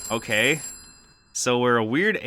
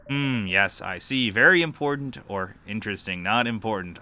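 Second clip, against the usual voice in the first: ordinary delivery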